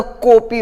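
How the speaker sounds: "कॉपी" said as kopi, rounded o; wrong o vowel. Shown as a demonstration. Teacher showing a mistake, "कॉपी" as kopi. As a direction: close the first vowel of "कॉपी" to long o. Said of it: "दोनों गलत है".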